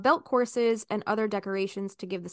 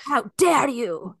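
slow, louder, mock-indignant; indignant Eastlake; 6 dB louder, slower than usual. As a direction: huff it like an offended Brit. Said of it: "issued a furious disclaimer".

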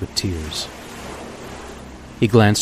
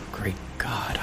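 a sudden hushed whisper; whispered oath; 10 dB quieter than usual. Acted. Stranger to Isbister, an exhausted whispered oath. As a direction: breathe it out without voice. Weary man's outburst.

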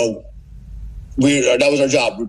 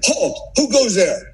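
higher, slow sentry call; sentry challenge; 8 semitones higher, slower than usual. Retold or imitated. Sentry challenges him in dark.

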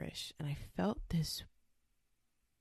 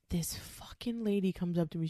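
ordinary talk, nothing marked

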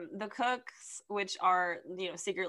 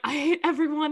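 mock rage; 4 semitones higher, 5 dB louder than usual. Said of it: "That's when I lost my mind".

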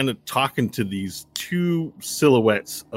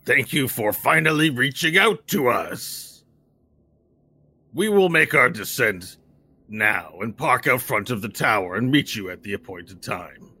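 lightly voiced formal message, slowly begun; 3 semitones higher than usual. A message heard on the sending stone, lightly voiced caller. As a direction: read out as an incoming message. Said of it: "and you hear".

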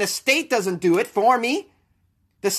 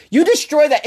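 angry; 8 semitones higher, 6 dB louder than usual. Real, not acted. Angry rant about killing monster.

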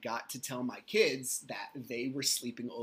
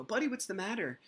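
gentle concern; 9 semitones higher than usual. Retold or imitated. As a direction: ask it like a caring dad. Dad asks crying son.

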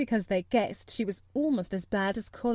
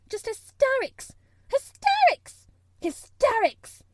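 very high, loud shouts, hammered word by word; stamping fury; 14 semitones higher, 8 dB louder than usual. A narrator's reading. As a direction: shriek each one like a stamp of the foot. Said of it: "she stamped each time she said it".

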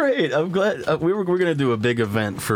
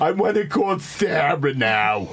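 grandma voice; 7 semitones higher than usual. Acted. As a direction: call out like a grandma. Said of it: "doing my own grandma voice".

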